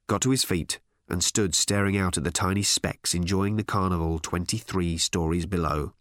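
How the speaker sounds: plain reading voice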